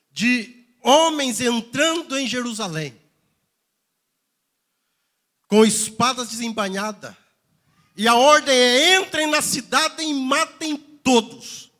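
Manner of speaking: loud, high-pitched preaching. Real, not acted. Pastor retelling Ezekiel's vision to the church, preaching loudly. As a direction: preach the vision at full voice, stretching the final order.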